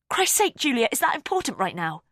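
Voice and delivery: exasperated. A narrator's reading. Exasperated outburst.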